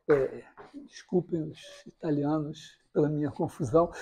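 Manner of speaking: ordinary talk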